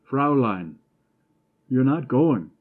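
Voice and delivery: protesting shout as she walks away; shouting after her. A narrator's reading. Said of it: "he shouted".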